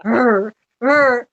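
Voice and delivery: low grumbling growls; imitated dog growl. Owner imitating her dog's grumble at the song. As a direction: growl twice like an annoyed dog. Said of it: "She'll go".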